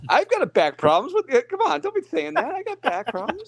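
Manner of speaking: put-on high-pitched whiny old man; whiny high complaining voice. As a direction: whine it out like a grumpy old guy. Mocking complaint about back.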